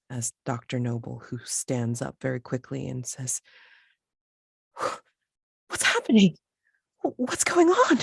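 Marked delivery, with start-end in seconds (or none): 5.7-8.0 high, loud, startled questions; alarmed outcry. Acted. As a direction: call out in rising panic. Character startled by cosmic swirl.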